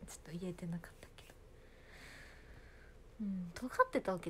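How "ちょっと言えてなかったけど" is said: quiet aside. Real, not acted.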